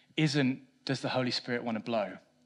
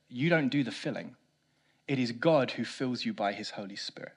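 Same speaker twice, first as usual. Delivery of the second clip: ordinary delivery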